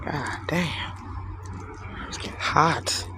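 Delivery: breathy under-the-breath whisper to himself; whispered muttering. Real, not acted. Streamer whispering to himself between rants, hushed. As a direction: whisper it to yourself, barely audible.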